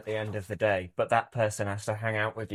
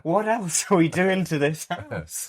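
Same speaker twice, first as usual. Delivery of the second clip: mock dismay; 6 semitones higher, 4 dB louder, slower than usual. Acted dismay at house work.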